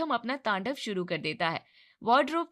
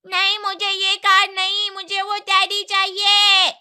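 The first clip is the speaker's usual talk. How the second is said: put-on high, loud, slow child yell; child tantrum; 10 semitones higher, 12 dB louder than usual. A narrator's reading. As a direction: shout like a stubborn kid demanding a toy. Spoiled child yelling for teddy. (ऊँची आवाज़ में बच्चे का ज़िद भरा चिल्लाना)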